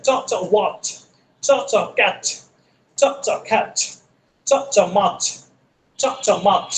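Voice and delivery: ওয়াচ ending on a crisp ch; ch sound. Shown as a demonstration. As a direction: snap the ch at the end of ওয়াচ. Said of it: "বাংলা হচ্ছে চর মতো উচ্চারিত হয়".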